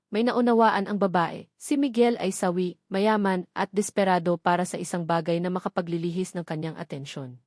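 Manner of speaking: usual speaking voice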